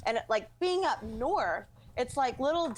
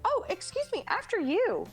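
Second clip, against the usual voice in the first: much higher, sugary courtesy; polite woman imitation; 10 semitones higher than usual. Southern woman yielding the grocery aisle to her, high-pitched mock politeness. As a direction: mimic an over-polite shopper giving way.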